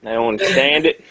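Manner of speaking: hammed-up Texan accent; mock Texan. Friend parodying the attempt, mock Texan accent. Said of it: "Texan accent".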